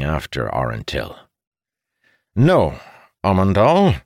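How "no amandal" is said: loud, high, stern refusal. A narrator's reading. Father halts his departing son, stern. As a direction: stop your son firmly. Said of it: "Aranil said sternly".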